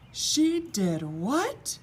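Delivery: shocked, slow high cry; the king cries out in shock at the news; cried out. A narrator's reading. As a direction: gasp it out in disbelief. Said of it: "cried the king".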